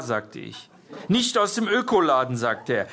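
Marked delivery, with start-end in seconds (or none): 1.1-2.4 higher, pointed questioning; indignant interrogation. A narrator's reading. Jörg grilling a parent about the shop, indignant. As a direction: press the point, pitched up.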